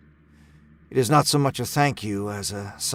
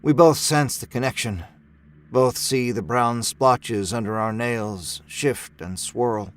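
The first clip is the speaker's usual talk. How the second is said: ordinary delivery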